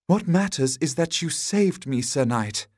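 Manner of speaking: high damsel voice, falling on the title; insistent damsel. A narrator's reading. Damsel repeating her thanks to the knight, high and insistent. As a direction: insist on your gratitude in a high voice.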